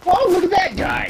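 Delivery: loud high exclamation; excited shout. Real, not acted. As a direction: yell it out in surprise. Sudden enemy sighting.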